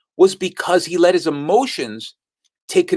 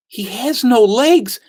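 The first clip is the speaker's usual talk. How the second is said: higher, slow, stunned disbelief; drawn-out astonishment, voice raised in pitch; shocked repeat; 6 semitones higher, 4 dB louder, slower than usual. Retold or imitated. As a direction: repeat the news aghast, pitched up. Teller's shocked repeat of revelation.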